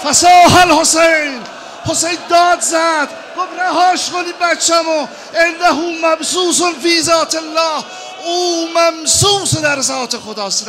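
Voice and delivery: loud, high lament preached at full force; wailing mourning sermon. Acted. Preacher wailing Hajar's grief to the mourners, loud and high. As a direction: cry the story out to the mourners.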